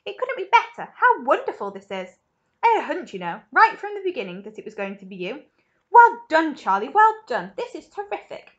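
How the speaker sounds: loud, high, gushing delight; excited cry. A narrator's reading. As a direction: heap on delighted praise with big emphasis. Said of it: "he cried".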